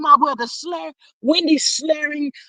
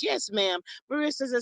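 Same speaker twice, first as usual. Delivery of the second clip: normal talking voice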